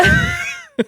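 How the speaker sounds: high-pitched squealing laugh; delighted high laugh; high squeal of laughter. Real, not acted. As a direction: let a squeaky laugh burst out. Laughs at [name] joke.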